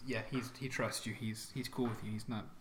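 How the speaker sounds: ordinary delivery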